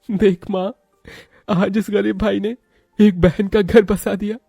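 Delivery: very high, plaintive voice; high-voiced acted emotion; emotional poor brother. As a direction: speak up to mother, high and heartfelt. Brother addresses his dead mother.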